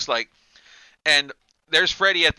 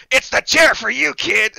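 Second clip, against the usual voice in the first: higher, louder mocking villain line; Freddy taunt; 8 semitones higher, 7 dB louder than usual. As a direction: taunt the kid with glee. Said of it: "his other voice where it's".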